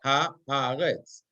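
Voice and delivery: teacher counting out the syllables of haaretz. Shown as a demonstration. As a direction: split haaretz into ha, ha, retz with a clear break between each. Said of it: "I have three syllables".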